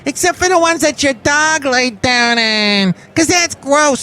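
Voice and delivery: a silly character who always asks do you know that; Bison Bentley voice. Acted. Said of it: "Bison Bentley answers".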